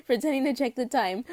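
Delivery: plain reading voice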